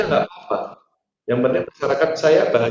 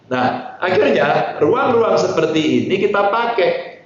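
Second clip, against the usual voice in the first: ordinary delivery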